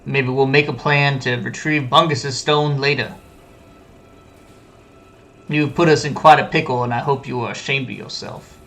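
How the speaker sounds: ordinary delivery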